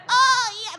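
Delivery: very high loud moan; shaking porn moan. Retold or imitated. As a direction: overdo a shaking climax moan. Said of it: "They start shaking".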